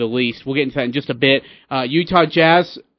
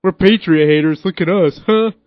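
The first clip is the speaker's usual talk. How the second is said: mocking Patriot hater; 5 dB louder than usual. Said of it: "You and Colin Cowherd and Max Kellerman".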